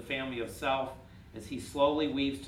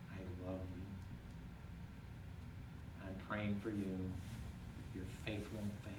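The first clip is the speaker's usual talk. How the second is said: tender Jesus; 4 semitones lower, 10 dB quieter, faster than usual. Pretend. Jesus looking at Peter with love, soft and tender. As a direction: speak it like a gentle promise. Voicing God comforting listener.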